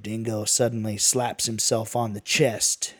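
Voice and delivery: plain reading voice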